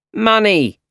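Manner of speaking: a loud, high bark for money; shout. Acted. Landlord yelling at tenant for late rent, loud shout. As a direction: yell it up the stairs. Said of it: "shouted Mister Ditkovich".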